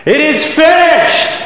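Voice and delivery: high, slow triumphant shout; ringing shout of triumph; Jesus shouting. Acted. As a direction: cry it out like a victory call. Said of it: "the voice of Jesus shout".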